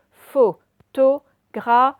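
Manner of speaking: "Photographe" très étiré, plus fort; dictée lente. Shown as a demonstration. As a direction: articuler chaque syllabe de "Photographe". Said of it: "dictée lente". (dicter syllabe par syllabe)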